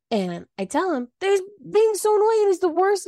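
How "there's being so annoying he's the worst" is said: much higher and louder, fed-up venting; exasperated outburst. Retold or imitated. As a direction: explode once the guests are gone. Venting about husband after guests.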